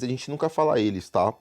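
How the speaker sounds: usual speaking voice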